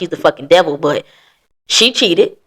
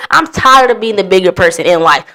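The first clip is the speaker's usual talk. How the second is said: ordinary talk, nothing marked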